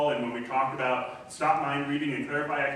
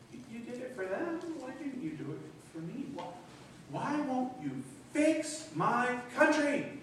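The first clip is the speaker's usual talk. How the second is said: pleading lament; 9 semitones higher, 4 dB louder than usual. Retold or imitated. Disappointed believer pleading with Jesus.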